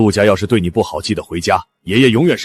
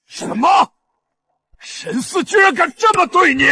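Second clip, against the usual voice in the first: very high-pitched, incredulous fury; shocked outrage. Acted. Grandfather hears how his granddaughter was treated, shocked outrage. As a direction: explode in disbelief and anger.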